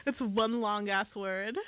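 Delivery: ordinary talk, nothing marked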